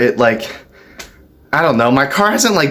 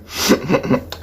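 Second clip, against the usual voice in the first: quick run of voiceless hiccup noises; involuntary little stuttered hiccups; soft, repeated hiccup tic; 5 dB quieter than usual. Real, not acted. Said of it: "you know the hiccup noise I make".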